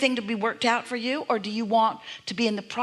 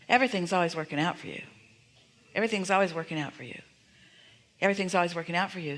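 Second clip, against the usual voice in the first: fast repeated mantra; much faster than usual. Shown as a demonstration. Teacher drilling the guest with the phrase, fast mantra. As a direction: rattle off Everything's always working out for you quickly. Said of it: "It's slightly different".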